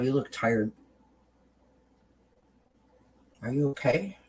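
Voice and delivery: tender reassurance to a tired friend in the mirror; kind mirror talk. Shown as a demonstration. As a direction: talk to yourself like your best friend. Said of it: "And you say this".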